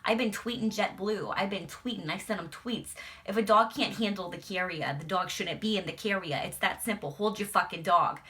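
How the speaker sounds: loud, furious complaining; loud mock New York rage; irate New Yorker rant. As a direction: rant at the whole plane, fed up. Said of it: "she was not fucking having it".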